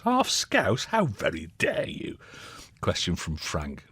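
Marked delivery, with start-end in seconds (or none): 0.0-0.9 Half Scouse? jumps much higher in mock disbelief; mock outrage. Host mock-outraged at texter's question. 0.9-2.2 mock offence. Host mock-offended after Scouse question.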